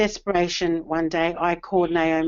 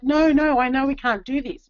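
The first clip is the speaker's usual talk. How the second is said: son's protest; 6 semitones higher than usual. Retold or imitated. Son protests, can't afford.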